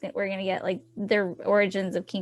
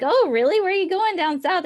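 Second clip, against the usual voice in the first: high, rising, louder question; retold "oh really?" pitched up; mock-interested question; 9 semitones higher, 6 dB louder than usual. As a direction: feign surprise and ask eagerly. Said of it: "And I'm like".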